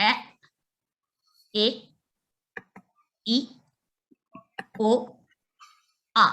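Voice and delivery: a, e, i, o, a as short clipped sounds, one at a time; short vowel sounds. Shown as a demonstration. Teacher lists the vowels for learners, short separate sounds. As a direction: say a, e, i, o, a as separate short vowels, long pauses between. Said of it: "the vowels are".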